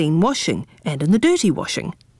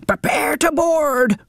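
high, slow sneaky pirate; pirate captain whisper; 8 semitones higher than usual. A narrator's reading. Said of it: "whispered the pirate captain".

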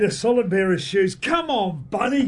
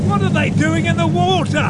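alarmed; 9 semitones higher, 3 dB louder than usual. Acted. Spotting people in the water.

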